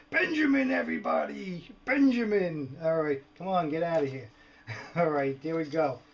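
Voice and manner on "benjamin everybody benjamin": playful high-pitched announcing call; mock showman introduction. Vlogger introducing his lizard Benjamin to viewers, mock showman call. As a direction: present a mascot to an imaginary crowd, higher.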